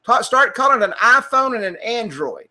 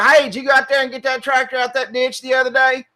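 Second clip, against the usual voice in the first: higher-pitched country twang in one run; 4 semitones higher, faster than usual. Shown as a demonstration. Asking a neighbor about his tractor, put-on country talk. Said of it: "you talk kinda like a hick".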